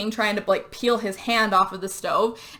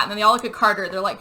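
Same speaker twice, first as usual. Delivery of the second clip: usual speaking voice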